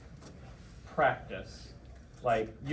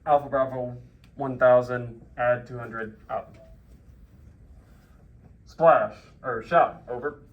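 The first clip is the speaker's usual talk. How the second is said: loud, measured radio readback; 9 dB louder, slower than usual. Shown as a demonstration. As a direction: read it back firmly over the net. Reads back the fire call.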